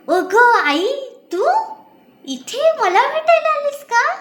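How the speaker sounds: surprised daughter. Acted. Daughter sees her mother arrive unexpectedly, surprised. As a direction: cry out in delight at the door.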